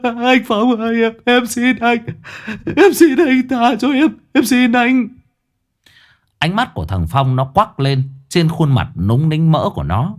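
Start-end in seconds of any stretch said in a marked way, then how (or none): 0.0-5.1 much higher, frantic begging; stammering desperate plea. A narrator's reading. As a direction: plead for your life, voice cracking. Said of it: "nó lắp bắp".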